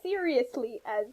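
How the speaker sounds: ordinary talk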